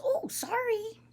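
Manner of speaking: high, small, softened voice; little boy apologizing. Acted. Boy apologizing to the old man, timid. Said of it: "the little boy says".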